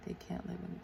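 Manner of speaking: very soft note to self; hushed self-dictation; murmuring while writing. Real, not acted. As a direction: say it under your breath as you write. Murmurs words while writing them down.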